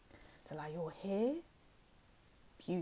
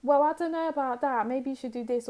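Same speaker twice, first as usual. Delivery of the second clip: mocking meddling relative, louder and higher; nosy relatives; 6 semitones higher, 7 dB louder than usual. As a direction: sneer it like a know-it-all aunt. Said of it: "the relatives are like".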